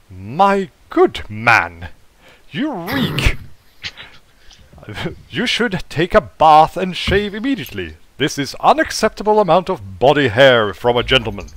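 haughty English butler, pitch swinging up on key words; posh English accent. Acted. Robot butler scolding a smelly guest, posh English accent. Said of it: "a posh English accent".

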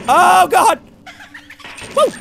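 sudden high shriek; fearful scream. Real, not acted. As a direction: shriek in shock. Jump scare.

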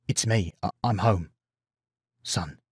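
hushed, halting admission; tentative reveal. A narrator's reading. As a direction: falter softly through the reveal, fading to almost nothing. Nervous father reveals himself to son.

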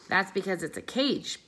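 a lightly voiced blunt little dog; Bob the dog. Dog Bob bluntly telling the gorilla, lightly voiced dog. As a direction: toss it off like a tactless dog.

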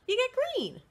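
high sing-song answer; high teacherly reveal; bright reveal. Acted. Explaining mixing to children.

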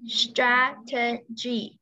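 "strategy" very slow, syllable by syllable; slow word demonstration. Shown as a demonstration. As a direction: stretch out each syllable of "strategy". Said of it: "Từ này là". (đọc thật chậm từng âm tiết "strategy")

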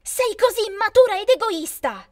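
high, loud accusing cry; loud, high furious outburst; angry shout. A narrator's reading. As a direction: fling the insult at her sister. Sisters quarrel.

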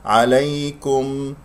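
slow "عليكم" gliding gently through ay; soft ya. Shown as a demonstration. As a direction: draw out "عليكم" without pressing the y. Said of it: "ياء لين، لا تضغط على المخرج".

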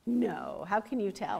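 ordinary delivery, nothing marked

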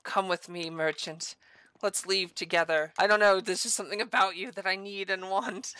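low, soft sweet talk; play-acted love murmur; mock-tender confession. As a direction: sweet-talk him like a secret crush, low. Mock love talk to game merchant.

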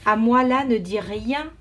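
plain reading voice

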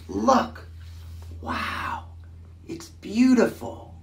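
high, wonderstruck exclaiming; awed exclamation. A narrator's reading. People in the car, marvelling at the view.

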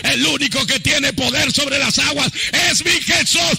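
loud, high, impassioned preaching shout; triumphant proclamation. Retold or imitated. Peter realises it is Jesus on the waters, triumphant shouting. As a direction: build to a roaring climax, stretching the final words.

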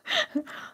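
short high-pitched giggle. Real, not acted. Vlogger giggling at viewer Roxy, short high giggle. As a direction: squeak out a quick laugh. Laugh after teasing Roxy.

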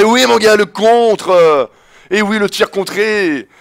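high, loud crowing; loud gloat. Real, not acted. Gamer taunting after a blocked shot, loud crowing. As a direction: celebrate the block in his face.

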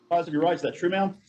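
ordinary talk, nothing marked

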